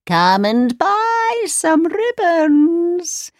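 high, slow crone calling her wares; the queen in disguise crying ribbons for sale; disguised old pedlar. Acted. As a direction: lure the girl like a sweet old hawker. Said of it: "she cried".

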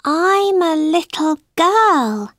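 put-on slow, unsure little girl; Alice answering the bird slowly. A narrator's reading. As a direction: draw it out slowly, doubting herself. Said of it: "said Alice slowly".

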